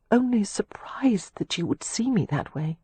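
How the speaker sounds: unvoiced, shaky whisper of surprise. A narrator's reading. Catherine whispering her surprise to Leo. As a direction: breathe it out in a hushed whisper. After a trembling breath.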